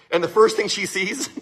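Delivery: usual speaking voice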